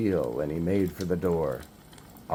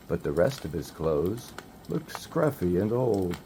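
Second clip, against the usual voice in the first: ordinary delivery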